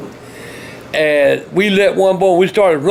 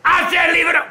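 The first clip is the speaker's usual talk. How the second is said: angry order; 8 semitones higher than usual. Real, not acted. Grandfather yelling at the camera holder, angry high command. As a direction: bark the order.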